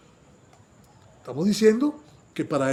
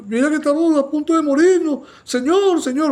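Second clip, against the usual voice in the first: loud, high cry of panic; fearful plea; 10 semitones higher, 7 dB louder than usual. Acted. Disciples in the storm begging the Lord, panicked shout. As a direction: beg him to wake and save them. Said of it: "pues, llenos de temor".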